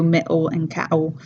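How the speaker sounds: "middle" and "cattle" with a catch in the throat mid-word; glottal stop. Shown as a demonstration. Teacher repeating her examples, "middle and cattle" with glottal stops. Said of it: "has that glottal stop in there".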